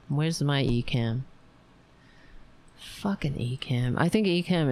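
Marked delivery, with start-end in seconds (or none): none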